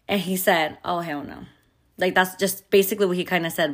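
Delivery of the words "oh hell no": softly imitated angry aside; under his breath. Pissed husband reacts to his son being scolded, under his breath. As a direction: say it low-volume, to yourself, retelling his anger. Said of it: "very under his breath".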